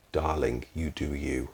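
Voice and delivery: low, soft South African accent, lightly imitated. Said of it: "lovely South African accent".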